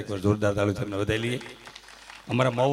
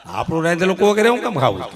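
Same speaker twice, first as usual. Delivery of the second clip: mock outraged laughing question; 6 semitones higher, 8 dB louder than usual. Comic outrage at the misunderstanding.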